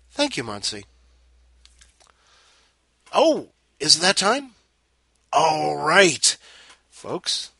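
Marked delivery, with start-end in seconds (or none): 3.1-7.5 high, loud showman call; excited. Real, not acted. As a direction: announce it big. Host announcing segment.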